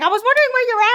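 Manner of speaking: high, loud chatter; excited gushing. Retold or imitated. Catching up with Samantha, high excited gush. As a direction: gush at your friend.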